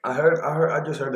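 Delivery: loud, high, urgent stop call; excited interruption. Real, not acted. Reactor halting the song in excited surprise.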